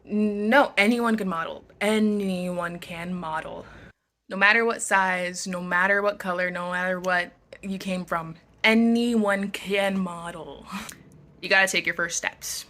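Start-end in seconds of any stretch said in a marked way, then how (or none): 1.8-3.7 "Anyone can model" drawn out at half speed; slow emphatic repeat. Real, not acted. Repeats answer slowly for emphasis. 8.6-10.7 "anyone can model" stretched out slowly; slow emphatic repeat. Real, not acted. Repeats main message for emphasis.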